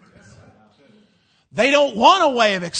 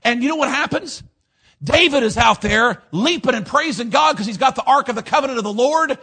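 ordinary delivery

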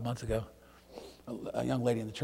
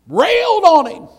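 loud, high, slow cry; preacher's shout; 20 dB louder, slower than usual. Acted. Preacher crying out to the church, loud and slow. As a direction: call it out from the pulpit.

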